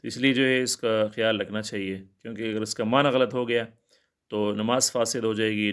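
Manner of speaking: normal talking voice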